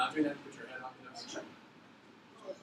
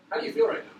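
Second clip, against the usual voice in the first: teasing question; faster than usual. Shown as a demonstration. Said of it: "I think that answers your question".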